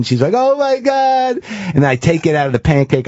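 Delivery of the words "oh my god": high, slow, choked-up mock sob; tearful imitation. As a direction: imitate her crying, much higher and drawn out. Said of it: "She starts crying".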